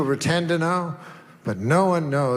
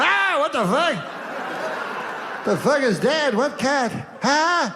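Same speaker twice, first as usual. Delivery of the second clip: confused son; 9 semitones higher than usual. Retold or imitated. Son walking in on his dead dad, high baffled shouting. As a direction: squawk in total confusion.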